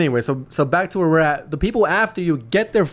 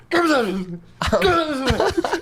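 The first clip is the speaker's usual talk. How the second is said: mock whiny plea; 11 semitones higher than usual. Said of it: "I see your head going".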